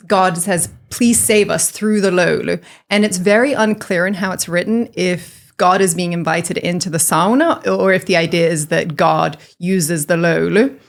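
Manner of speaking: ordinary talk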